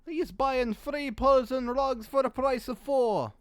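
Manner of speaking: put-on slow, deliberate merchant in a vague European accent; mystery European accent. Merchant explaining the purchase, in a put-on European accent. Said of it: "don't ask me what this accent is".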